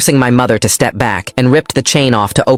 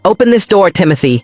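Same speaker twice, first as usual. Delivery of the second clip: mother shrieking; 8 semitones higher than usual. A narrator's reading. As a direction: shriek through the door at her son. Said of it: "she shrieked".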